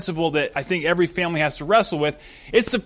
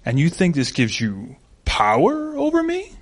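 Bane. Acted. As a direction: play the cool movie villain, rising at the end. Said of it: "Bane turns and looks and he goes".

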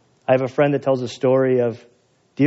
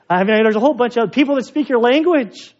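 worked up; 9 semitones higher, 3 dB louder than usual. Real, not acted. Preacher pushes the point hard.

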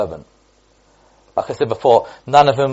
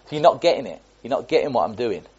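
ordinary talk, nothing marked

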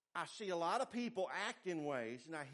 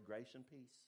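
softer, smiling Grace and peace; 6 semitones lower, 13 dB quieter than usual. Shown as a demonstration. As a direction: smile and say Grace and peace gently. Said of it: "look at you and smile and say".

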